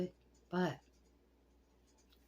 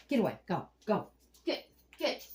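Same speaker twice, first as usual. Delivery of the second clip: sharp loud shooing commands; 3 semitones higher, 5 dB louder than usual. Real, not acted. Shooing a turtle away, sharp and loud. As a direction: drive him away with short barks.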